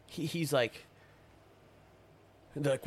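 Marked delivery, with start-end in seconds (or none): none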